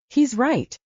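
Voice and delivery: firm, carrying voice from the doorway; loud and clear. A narrator's reading. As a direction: call it out clearly across the room. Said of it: "loud and clear".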